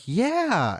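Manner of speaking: high, drawn-out retold yeah; dawning realization. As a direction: imitate someone pleasantly realizing they are recognized. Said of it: "And Rick's realization".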